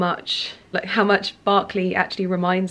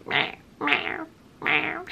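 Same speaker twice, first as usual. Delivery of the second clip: lightly imitated meowing; soft mock meow, rising higher; cat meow; 7 semitones higher, 6 dB quieter, much slower than usual. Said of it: "listening to his meow".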